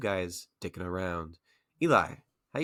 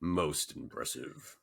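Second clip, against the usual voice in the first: slow, low Vader impression; Darth Vader; 3 semitones lower, 4 dB quieter, slower than usual. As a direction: intone it slowly like Vader. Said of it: "Sounded like Darth Vader".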